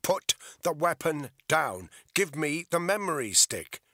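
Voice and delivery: loud, firm shouted command; shouting. A narrator's reading. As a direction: bark the order across the alley. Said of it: "he shouted over the hammering of the rain".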